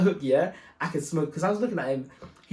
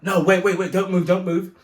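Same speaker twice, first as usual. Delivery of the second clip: commanding shout; 4 semitones higher, 7 dB louder than usual. Retold or imitated. Officer stopping them leaving.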